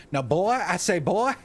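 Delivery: loud, high Southern bluster; hammed-up cartoon Southerner. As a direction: boom it out like a pompous Southerner. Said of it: "You could probably do a fo".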